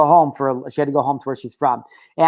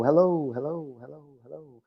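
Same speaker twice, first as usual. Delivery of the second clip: repeated hello trailing from soft to nearly inaudible; fading echo; 3 semitones lower, 9 dB quieter than usual. Shown as a demonstration. Hello echoing through the body, fading away. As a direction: let each hello die away like an echo. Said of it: "you can feel it echoing".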